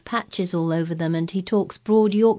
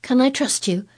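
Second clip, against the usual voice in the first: earnest, pleading question; a first anxious plea; imploring; 8 semitones higher, 3 dB louder than usual. A narrator's reading. Mary begging Colin to keep the secret, a first earnest plea. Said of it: "she implored".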